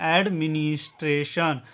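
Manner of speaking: Administration drawn out as ad, mi-nis, tre, shan; syllable by syllable. Shown as a demonstration. Teacher showing learners how to read the word, administration split into its parts. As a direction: say administration part by part. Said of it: "इसको पढ़ेंगे".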